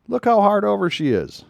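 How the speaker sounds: awed exclamation, high; amazed. Real, not acted. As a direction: pitch jumps high in amazement at "how". Amazed at turn.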